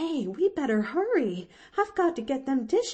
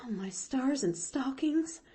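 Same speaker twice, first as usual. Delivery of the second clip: aghast, higher-pitched exclamation; gasped; 7 semitones higher than usual. A narrator's reading. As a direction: exclaim in shock without raising the volume. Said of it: "gasped Nancy".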